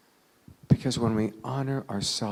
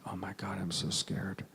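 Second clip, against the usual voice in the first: self-empathy; 2 semitones lower, 8 dB quieter than usual. Retold or imitated. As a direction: murmur it privately to yourself. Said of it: "gives himself that emergency dose of self-empathy".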